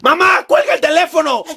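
loud, high annoyed shout; angry yell. Acted. Teen shouting at mom to hang up the phone. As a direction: snap at her to hang up.

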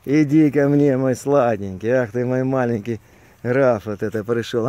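soft, low cooing to a pet; tender to a dog. Real, not acted. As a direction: coo gently at the dog.

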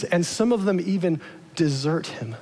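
ordinary talk, nothing marked

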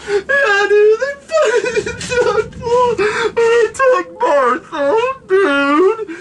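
high, wailing hammed-up sobbing; mock crying. [name] telling friends his Bertha got taken, mock sobbing. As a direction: blubber it like a heartbroken stoner. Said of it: "[name]'s crying".

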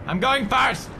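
high, loud gloat; taunting shout. Real, not acted. Player jeering at the companion while jumping, loud gloat. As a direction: jeer at him as you jump.

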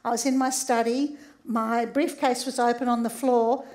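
ordinary talk

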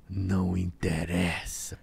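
low, slow, cold reply; eerie voice. Retold or imitated. Spirit refusing to name itself, low and slow. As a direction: drag it out low and dismissive. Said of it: "E eu ouvi".

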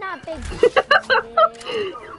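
loud protest. Real, not acted.

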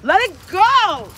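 long, drawn-out loud yell; shouting. Real, not acted. Owner yelling at her dog to drop her weave, loud and drawn out.

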